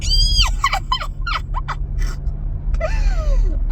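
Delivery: very high squeal breaking into laughter; panicked squeal-laugh. Real, not acted. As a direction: hysterical shriek of laughter. Shrieking at the lizard.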